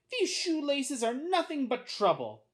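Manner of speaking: high-pitched grumble; frustrated little boy. A narrator's reading. Boy talking to himself about laces, frustrated and high. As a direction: complain to yourself in a boy's voice.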